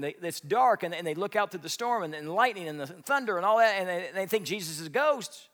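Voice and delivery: ordinary talk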